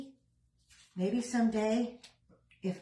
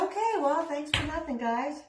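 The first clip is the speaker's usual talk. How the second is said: sarcastic; 7 semitones higher than usual. Retold or imitated. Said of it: "So it's like".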